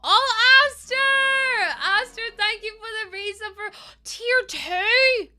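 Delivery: loud high squeal of thanks; overjoyed, high-pitched and slow; excited shout. Real, not acted.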